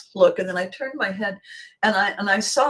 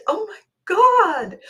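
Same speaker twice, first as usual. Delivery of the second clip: high, slow, louder; mock excited gasp; 12 semitones higher, 5 dB louder, slower than usual. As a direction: gush in amazement. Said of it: "and he said".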